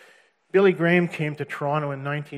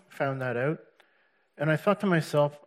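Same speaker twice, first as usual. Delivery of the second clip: ordinary talk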